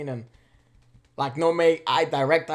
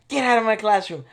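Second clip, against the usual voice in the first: angry teacher; 6 semitones higher, 6 dB louder than usual. Retold or imitated. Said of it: "she's like".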